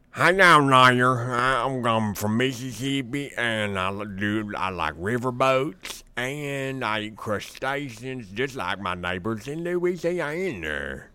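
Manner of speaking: slow, drawn-out Mississippi drawl; attempted Mississippian accent. Shown as a demonstration. Southern character practices for a movie role, in a slow Mississippi accent. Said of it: "I'm gonna do a Mississippian accent".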